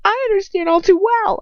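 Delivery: high, loud furious scream. A narrator's reading. Girl screams back at her captor. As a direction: scream it out of control. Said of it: "I screamed".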